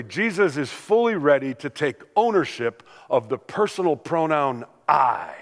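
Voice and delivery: ordinary delivery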